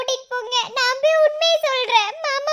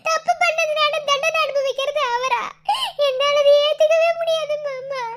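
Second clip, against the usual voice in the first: slow, drawn-out tearful begging; crying. Acted. Girl begging uncle to bring him out, crying. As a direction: sob out a guilty plea. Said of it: "அழாதக்கா".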